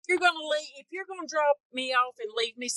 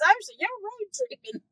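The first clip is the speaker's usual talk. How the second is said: much higher-pitched mock onlooker; quoted onlooker; 12 semitones higher than usual. Retelling the Walmart guy's question, quoted onlooker voice. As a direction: imitate a nosy stranger in a high voice. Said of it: "he goes".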